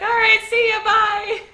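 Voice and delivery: high, loud breezy farewell; mock-cheerful see ya; cheery Luffy goodbye. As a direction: toss it off carefree and bright. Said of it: "And he's like".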